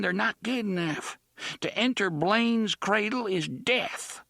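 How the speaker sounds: put-on high, grave old woman warning; an old woman pleading caution.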